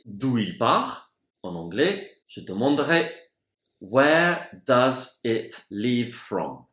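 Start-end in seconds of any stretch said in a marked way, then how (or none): 3.8-6.7 très lent, mot par mot; lent et articulé. Shown as a demonstration. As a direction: posez la question comme pour un débutant. Phrase modèle dictée lentement. (question modèle dite très lentement)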